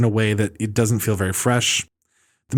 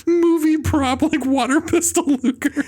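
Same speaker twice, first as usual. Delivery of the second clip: high, slow, laughing disbelief; laughing incredulously; slower than usual. Real, not acted. Laughing at the actress's prop gun, high and slow. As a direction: squeal it through laughter.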